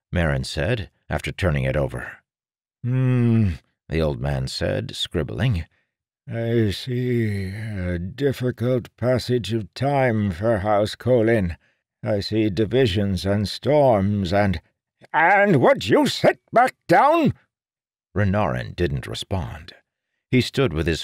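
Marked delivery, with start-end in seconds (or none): 2.8-14.6 put-on slow, high prophetic old man; old seer voice. 15.1-17.3 very high, loud, slow snap; exasperated old man snapping; irritated snapping. Acted. Seer irritated at Renarin.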